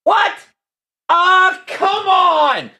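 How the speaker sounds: loud shocked protest; loud, high, outraged; outraged disbelief. Real, not acted. Outraged at wrong name.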